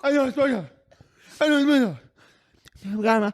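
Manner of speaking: high, laughing imitation of a crazed man; comic high-voiced raving; mock frantic babble. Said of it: "He's like".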